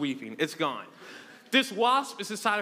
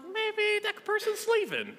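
high, slow wishful falsetto; sing-song hoping for a sign; playful high voice talking himself into donuts; hopeful self-talk; 14 semitones higher, slower than usual. Retold or imitated. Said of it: "Then I'm like".

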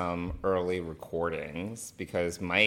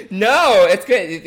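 much higher and louder, laughing; laughing reassurance; 12 semitones higher, 12 dB louder, much slower than usual. Real, not acted. Reassuring the co-host through laughter, high and loud.

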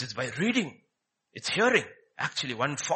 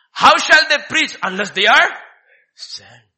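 much louder and higher, then dropping on sent; preaching shout; 6 semitones higher, 13 dB louder than usual. Acted. Preacher asking how they preach unless sent, loud. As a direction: hurl the question then let it fall.

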